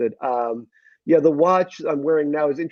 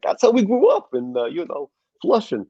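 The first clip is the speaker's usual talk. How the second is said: high, loud Queens comeback; proud mock Queens accent; 8 semitones higher, 3 dB louder than usual. Shown as a demonstration. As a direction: defend your roots in broad Queens. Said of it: "I can do a Queen's accent".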